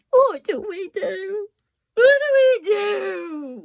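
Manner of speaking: high, loud crying plea; wailing. A narrator's reading. Cook sobbing to Louise about the lost princess, wailing. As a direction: wail it, voice breaking. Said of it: "wailed Cook".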